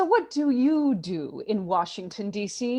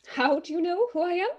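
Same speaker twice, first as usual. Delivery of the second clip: high anxious hostess; 8 semitones higher than usual. Acted. Flustered Peacock asks.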